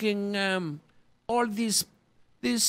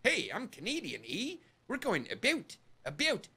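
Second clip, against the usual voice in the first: quieter mock Canadian accent with eh and aboot; 8 dB quieter than usual. Mocking how a Canadian should sound, in a mock Canadian accent. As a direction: poke fun at a Canadian accent. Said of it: "How come he's not going".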